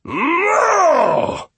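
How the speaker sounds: very high, loud roar of refusal; enraged roar. A narrator's reading. Steward rejects the rabbit's attempt, roaring. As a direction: roar it, all civility gone. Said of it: "he roared, all civility gone".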